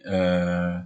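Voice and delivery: ordinary talk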